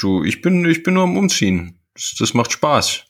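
ordinary delivery

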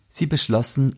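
ordinary delivery, nothing marked

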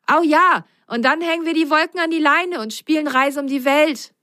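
fast, high and eager; excited. Acted. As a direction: burst out with joy. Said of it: "antwortete Ben begeistert".

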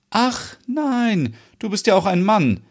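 high mocking ach nein; lightly voiced sarcasm; sarcastic. A narrator's reading. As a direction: scoff at the man. Sarcastic retort.

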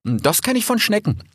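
a touch of a curious youngster; young fox recognising the crunch; lightly voiced young fox. Rix voiced by narrator.

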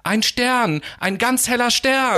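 put-on joyful child calling. Child spots the star on the tree, calling out high and happily. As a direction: call out in delight to everyone.

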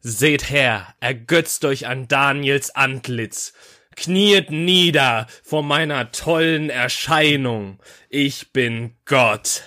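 slow, booming godlike proclamation; grand announcement. Pretend. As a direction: proclaim yourself a god, slowly. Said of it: "du gehst in so öffentliche Veranstaltungen rein mit".

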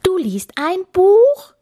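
high, loud, drawn-out question; incredulous shout. A narrator's reading. Captain astonished that Bella is reading, incredulous shout. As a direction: cry out in slow, rising disbelief. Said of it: "schrie Kapitän Kugelbauch".

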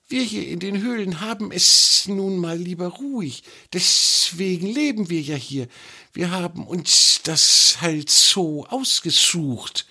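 the snake explaining cave life to the troll; snake explaining calmly. A narrator's reading.

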